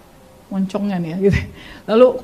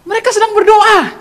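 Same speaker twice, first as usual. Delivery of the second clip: high, loud, insistent call; urgent calling out; 12 semitones higher, 6 dB louder than usual. Retold or imitated. Uncle tells niece they are praying, urgent call. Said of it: "Om saya langsung bilang kayak gini".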